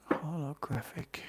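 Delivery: whispered aside. Real, not acted. Muttering card name to herself.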